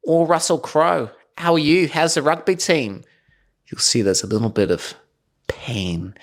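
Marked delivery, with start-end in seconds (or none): 0.1-3.0 interviewer voice. Retold or imitated. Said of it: "when they go".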